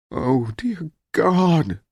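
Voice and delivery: high, very slow moan; drawn-out moan of dismay. A narrator's reading. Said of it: "Amelia Williams moaned".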